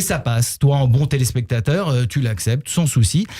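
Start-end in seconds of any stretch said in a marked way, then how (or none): none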